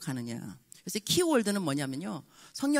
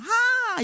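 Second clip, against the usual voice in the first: a sudden high, loud "ha!"; a startled shout of delight; high excited exclamation; 5 dB louder than usual. Shown as a demonstration. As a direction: burst out with a high-pitched gasp of joy. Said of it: "톤도 벌써 내 하이톤".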